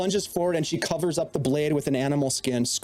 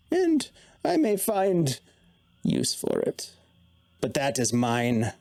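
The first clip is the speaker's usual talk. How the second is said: Mona's voice. Acted. GM voices Mona parting.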